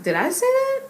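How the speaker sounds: very high-pitched startled disbelief; taken off guard. Retold or imitated. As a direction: question it in squeaky surprise, retold. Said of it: "it took me off guard".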